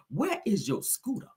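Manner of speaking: slow, laughing put-on diva voice; a slow, amused rerun of the famous scolding line; Nene Leakes impression. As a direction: tease it out through a laugh like the reality star. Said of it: "How Nene told, uh, Kim".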